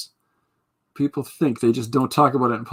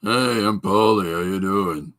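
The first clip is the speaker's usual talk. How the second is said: slow, drawn-out character imitation; Polly; 3 semitones lower, slower than usual. Answering a request for an impression, slow drawn-out greeting. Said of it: "do you have a Polly impression?".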